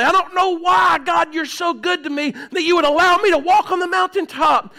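high, pleading outpouring to God; impassioned prayer. Acted. Acts out a frank, pleading prayer.

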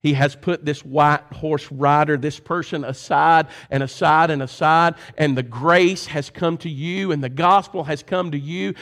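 ordinary talk, nothing marked

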